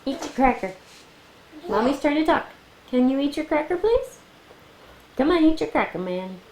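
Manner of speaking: high upbeat coaxing. Real, not acted. Urging toddler to eat.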